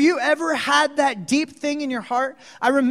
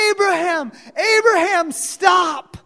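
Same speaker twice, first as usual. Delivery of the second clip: high, loud cry from heaven; God crying out urgently to halt the knife; urgent scream; 8 semitones higher, 7 dB louder than usual. Acted. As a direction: shout the name to stop him. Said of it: "God in urgency screams from the heavens".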